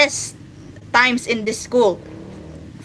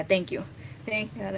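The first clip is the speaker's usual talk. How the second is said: usual speaking voice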